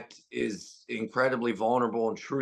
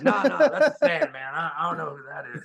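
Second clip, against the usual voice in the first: laughing denial; 2 semitones higher, 4 dB louder than usual. Retold or imitated. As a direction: deny knowing him through laughter. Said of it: "He goes".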